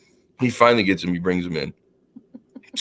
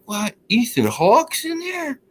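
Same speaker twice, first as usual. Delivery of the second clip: high, slow squeal of excitement; excited disbelief; slower than usual. Retold or imitated. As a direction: go way up, starstruck. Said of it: "I got all excited".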